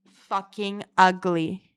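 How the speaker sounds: drawn out and loud; loud, slow self-mockery; loud slow insult. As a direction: hit it hard and slow. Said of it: "loud take".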